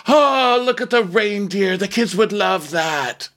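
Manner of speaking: high, slow mocking imitation; mock Nina. Mocking a castmate to a co-host, high gush. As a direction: ham up a gushing reality star, slow.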